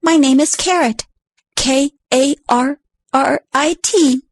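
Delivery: lightly voiced little critter; a little creature spelling its name, a touch high; small critter voice. Timid critter spells name to Susie, lightly voiced.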